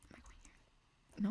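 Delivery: slow, very soft whisper; whispered aside. Real, not acted. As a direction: wonder under your breath. Muttered aside about the route.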